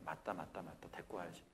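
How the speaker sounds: low, soft sheepish agreement; elder giving in to the kids; apologetic elder. Retold or imitated. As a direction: concede meekly. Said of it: "그냥 미안한 마음이에요".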